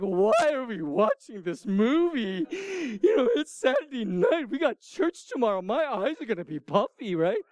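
high, hammed-up crying complaint; whiny mock sobbing, swooping high; mock tearful wail. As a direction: wail it like a sobbing wife, comically. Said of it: "that kind of crying".

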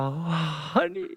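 soft, slow, hammed-up tender call; mock K-drama lover. Moses running to Zipporah in a K-drama scene, softly hammed-up. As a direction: play a swooning drama husband, slowly and softly. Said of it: "if you had to script this reunion".

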